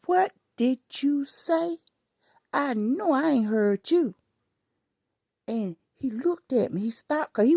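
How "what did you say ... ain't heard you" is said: put-on the old school mama in her confronting a rude child. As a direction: demand it like a mama who won't repeat herself. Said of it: "the old school mama in me said".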